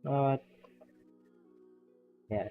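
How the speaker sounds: ordinary delivery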